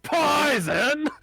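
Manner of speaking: outraged shout. Acted. Old goblin outraged.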